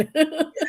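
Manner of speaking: short high giggle; a quick bright laugh at herself. Real, not acted. Laughing at her own mock panic, high giggle.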